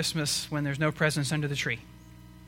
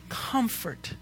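soft tender comfort; 6 semitones higher, 5 dB quieter than usual. Acted. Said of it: "Or is he saying".

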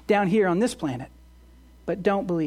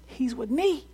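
reassuring Jesus; 5 dB quieter than usual. Acted. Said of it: "who says".

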